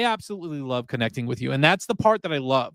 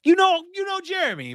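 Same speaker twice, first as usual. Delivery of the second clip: sing-song teasing. Acted.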